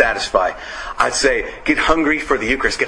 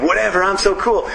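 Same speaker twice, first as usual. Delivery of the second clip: mock cool; 5 semitones higher than usual. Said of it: "my brother's like".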